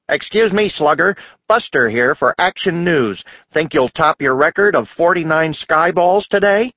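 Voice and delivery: boy pretending to interview a teammate on Action News; mock news reporter. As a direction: tease her like a TV sportscaster.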